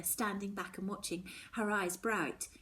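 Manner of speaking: ordinary delivery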